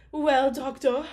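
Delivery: anxious posh princess pleading. Acted. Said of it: "She's like the posh voice".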